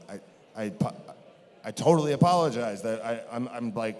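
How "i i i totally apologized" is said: ordinary talk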